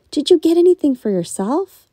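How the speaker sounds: high, loud question; eager girl asking; daughter's voice. A narrator's reading. Daughter asks.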